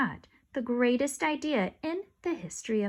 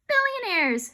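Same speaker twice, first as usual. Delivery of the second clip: high, loud shout; excited shout; 10 semitones higher, 5 dB louder, slower than usual. Acted. Ryan topping the others, high and loud. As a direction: yell it out greedily. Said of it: "shouted Ryan".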